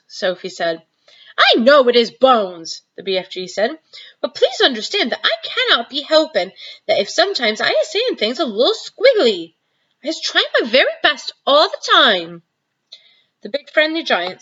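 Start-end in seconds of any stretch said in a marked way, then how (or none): 1.4-2.8 louder, protesting giant; BFG giant voice. Acted. 4.2-12.4 higher, earnest giant plea; pleading giant. Acted.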